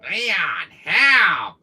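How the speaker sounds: loud, falling cry for help; hammed-up plea for rescue; bad Ashley impression. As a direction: call out as a badly faked damsel. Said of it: "there's my bad impression".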